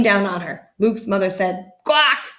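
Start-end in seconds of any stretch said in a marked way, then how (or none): 1.8-2.3 high, loud squashed quack. A narrator's reading. Mother flattened by the moose gives a quack. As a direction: squeeze out a startled quack.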